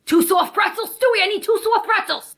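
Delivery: high call back to the kitchen; calling out order. Acted. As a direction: shout the order to Stewie. Waitress calls order to kitchen.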